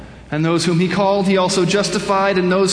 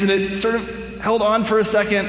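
ordinary talk, nothing marked

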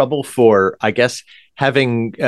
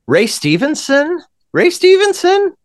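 questioning surprise; 3 dB louder than usual. Real, not acted. Checking if others know him.

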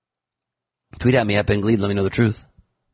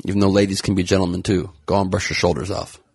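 ordinary delivery

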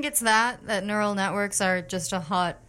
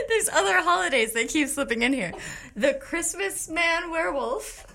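laughing; 7 semitones higher than usual. Real, not acted. Giggling while reading list.

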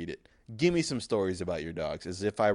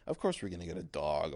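normal talking voice

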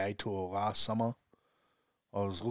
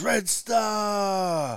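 high, loud cheering shout; crowd cheering; 12 semitones higher, 9 dB louder than usual. Retold or imitated. Shoppers cheering the team name together, loud. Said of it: "And everyone's".

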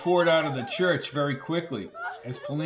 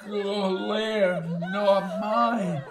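high, slow, slurred mumble; dying slur; 6 semitones higher, much slower than usual. A narrator's reading. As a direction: labour out each phrase as you fade. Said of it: "his voice tapered into heavy breathing".

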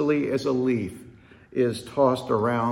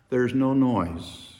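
ordinary talk

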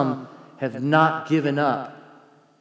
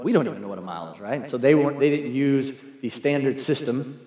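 ordinary delivery

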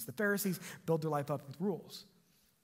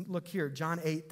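ordinary talk

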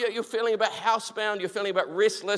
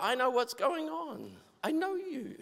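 soft, raised, gentle voice; tender reassurance; 6 semitones higher, 7 dB quieter than usual. Acted. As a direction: comfort quietly, as one who understands. God reassures an overwhelmed person.

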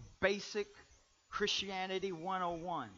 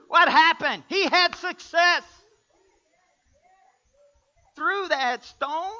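high, shouted preaching, slowing down; full-force preaching; 13 semitones higher, 17 dB louder, slower than usual. Real, not acted. Preacher telling the congregation how David won, full-force preaching. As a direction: shout it slowly in triumph.

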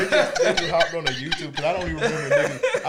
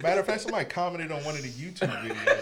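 ordinary delivery

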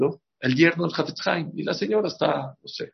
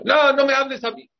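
dismissive; 11 semitones higher, 5 dB louder than usual. Retold or imitated. Mocking someone refusing help.

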